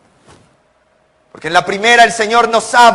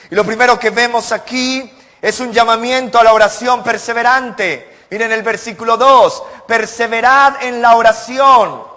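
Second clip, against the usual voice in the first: raised, higher pulpit reading of scripture; preaching; 5 semitones higher than usual. Acted. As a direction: proclaim the verse to the congregation. Reads Colossians with preaching fervor. (predicando con fervor, voz más alta)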